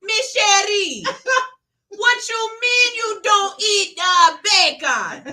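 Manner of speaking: put-on comic Caribbean accent, stretched words; Caribbean nana accent. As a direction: play an exasperated Caribbean nurse, drawn out. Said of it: "sound like she got".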